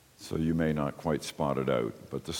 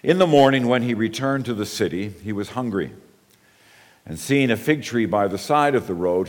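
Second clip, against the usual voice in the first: louder, brisker scripture reading; rereading scripture; 4 semitones higher, 5 dB louder than usual. Acted. Pastor rereading the verses to the congregation, louder. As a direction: reread the text with energy. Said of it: "let me begin by rereading".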